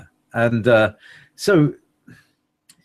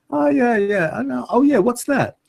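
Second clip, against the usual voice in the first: much higher, silly duck ambassador voice; 12 semitones higher than usual. Pretend. Duck ambassador asks about a Christmas photo in a high silly voice. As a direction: play a curious duck envoy.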